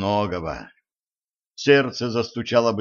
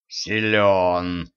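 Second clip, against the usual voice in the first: lower, drawn-out respectful verdict; 3 semitones lower than usual. A narrator's reading. Кощей impressed by his rival's stamina, respectful. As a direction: state it with slow respect. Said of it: "уважительно констатировал Кощей".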